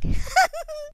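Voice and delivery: shrill canned-style cackle after the punchline; high cackle. Real, not acted. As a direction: burst into a high cackling laugh. Host laughs at own joke.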